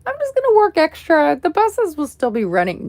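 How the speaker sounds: high, loud, singsong imitation of her past self; mock naive self. To viewers, mimicking her past self's naive plan, high and loud. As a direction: play your overconfident past self, high and bright. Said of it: "'cause I was like".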